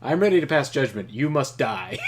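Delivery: loud, stately warlord reading; Trakov voice. Acted. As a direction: give it weight like a villain's speech.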